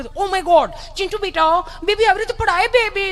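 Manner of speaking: put-on high, cooing mother alarmed over her baby; fussing mother. Doting mother fussing over child. (બાળક પર લાડ કરતી માતા, ઊંચા અવાજે)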